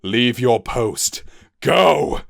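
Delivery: captain ordering Hubble off the bridge, shouting; shouted order. Acted. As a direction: command him to go. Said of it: "he shouted".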